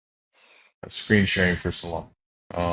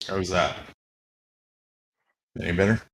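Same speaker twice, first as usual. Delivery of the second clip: crackly, echoey mic sound; crackling mic; faster than usual. Real, not acted. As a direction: speak through a crackling echo. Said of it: "You're crackling a little bit".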